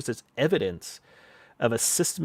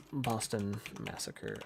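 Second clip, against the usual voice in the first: muttering while typing; 2 semitones lower, 10 dB quieter, slower than usual. Real, not acted. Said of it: "type a lot for that".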